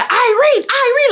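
high, loud mocking call of her name; mock screaming a name. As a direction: call her name like a pushy guy shouting across the lot. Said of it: "like, screaming my name".

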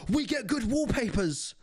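high, excited voice of an iPhone fan; hammed-up iPhone fan, pitched high; mocking iPhone user. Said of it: "iPaw be like".

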